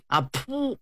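sharp high puff of breath with a short "ah"; magic puff. Acted. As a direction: blow a quick spell puff. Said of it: "杨戬用嘴一吹".

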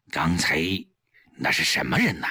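low, suspicious man's voice; wary question. A narrator's reading. Suspicious husband questioning his wife about the visitor, wary. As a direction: probe warily, voice kept down. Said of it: "警惕地问".

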